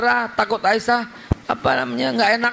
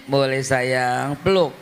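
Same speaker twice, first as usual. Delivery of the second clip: put-on lower, softer, slow cooing to a child; soft, slow, lower parent's coaxing; tender parent; 8 semitones lower, 3 dB quieter, slower than usual. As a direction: soothe the child gently.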